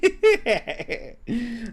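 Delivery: high bubbly giggle; giggling laugh. Acted. March giggling at the ghost claim, high. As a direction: burst into a girly giggle.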